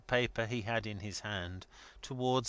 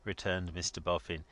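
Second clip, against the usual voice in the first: usual speaking voice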